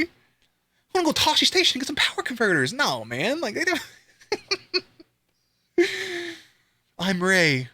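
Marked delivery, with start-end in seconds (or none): 0.0-2.7 put-on high, fast whiny farm boy; whiny Luke. Fan mocking Luke's old complaint, high whiny imitation. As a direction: whine it like young Luke. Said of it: "Luke didn't wanna be there".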